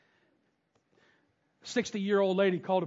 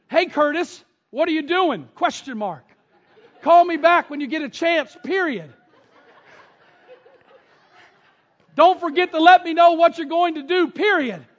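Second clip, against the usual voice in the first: louder and higher, with laughs breaking in; mock dictation; 8 semitones higher, 9 dB louder than usual. Preacher retelling his voicemail to friend Curtis, amused dictation. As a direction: read out the punctuation like talking to Siri.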